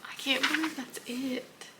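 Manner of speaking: stunned and weepy at the ending; tearful disbelief. Real, not acted. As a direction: say it high through tears. In tears at book's end.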